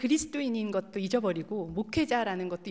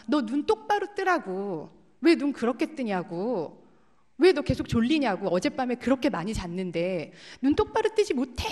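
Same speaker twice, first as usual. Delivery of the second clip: 아이를 몰아세우듯 크게 재연한 꾸중; 다그치는 고함; 5 dB louder than usual. Retold or imitated. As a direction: scold a child loudly, pressing hard. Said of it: "막 다그치면서 막 소리를 지르면서". (큰 소리로 다그치듯)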